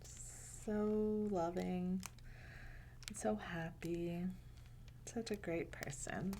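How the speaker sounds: slow tender hush; soft whisper. Real, not acted. Reader praising Allah to the children, tender whisper. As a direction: admire him in a whisper.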